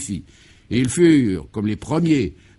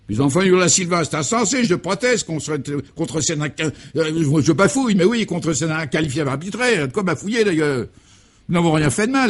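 higher, louder indignant protest; Guy protests the absurd arrest, flustered; 6 semitones higher, 4 dB louder than usual. Acted.

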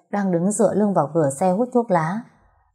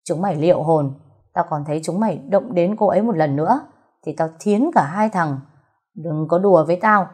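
ordinary delivery, nothing marked

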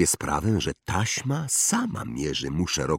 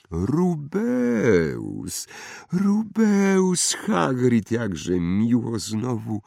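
high, drawn-out greeting; wandmaker, delighted recognition; 7 semitones higher, much slower than usual. Acted. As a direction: greet an old acquaintance with swooping, slow delight. (uradowany wytwórca różdżek, wysoki, przeciągły głos)

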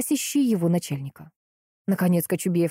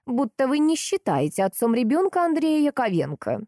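mild flustered indignation, voice raised in pitch; higher, affronted protest; indignant; 7 semitones higher than usual. A narrator's reading. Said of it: "вспыхнула до корней волос от гнева". (с лёгким возмущением)

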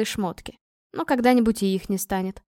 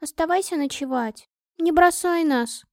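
pleading; 6 semitones higher than usual. A narrator's reading. Friend begs her not to leave at night, pleading. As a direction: beg her to stay, stretching the end. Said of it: "умоляла Лиза".

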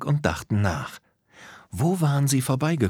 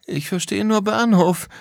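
high-pitched, baffled complaint; groaning in confusion; 12 semitones higher, 4 dB louder than usual. Acted. Lisa cannot follow the letters, groaning high. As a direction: moan it in a high character voice. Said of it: "stöhnte Lisa".

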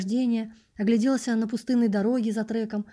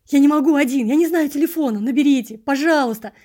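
loud, higher frantic begging; desperate pleading; 5 semitones higher, 9 dB louder than usual. Acted. Narrator loudly pleads with the passerby to dial. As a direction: plead at full voice.

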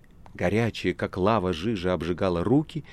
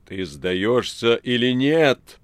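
loud, slow, menacing demand; terrible shout; 4 semitones higher, 8 dB louder, much slower than usual. A narrator's reading. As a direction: bellow it slowly and threateningly. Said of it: "прокричал страшным голосом Воланд".

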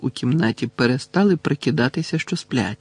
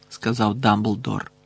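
ordinary delivery

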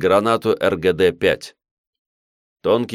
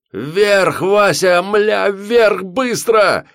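sudden high terrified scream; panicked yell; 14 semitones higher, 6 dB louder, much slower than usual. Acted. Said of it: "Петро заорал так неожиданно".

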